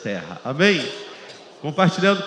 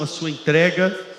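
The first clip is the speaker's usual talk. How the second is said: ordinary delivery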